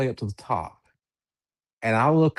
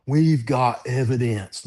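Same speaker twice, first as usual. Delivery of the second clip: ordinary delivery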